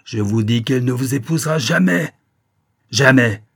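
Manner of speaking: loud, insistent cry; shouted in angry defiance; defiant shout. A narrator's reading. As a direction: slam it down like a fist on the table. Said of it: "elle y assena son poing en criant".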